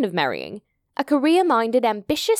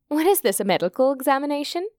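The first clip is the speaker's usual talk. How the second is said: higher-pitched, quick, irritated comeback; irritated retort; 5 semitones higher, faster than usual. Acted. Alex to the girl questioning her symptoms, irritated retort. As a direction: retort as if fed up with being questioned. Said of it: "irritated by her persistent questioning".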